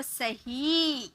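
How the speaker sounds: सही with the ही stretched long and soft; drawn-out ही. Shown as a demonstration. Teacher shows students the long ई sound in सही. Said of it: "ही को थोड़ा टान ले रहे हैं ना".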